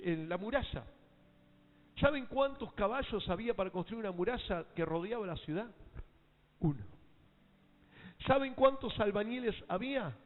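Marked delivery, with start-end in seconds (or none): 2.0-10.1 high, loud preaching, hammering each question; full-force preaching. Acted. Preacher rousing the congregation with loud, high preaching.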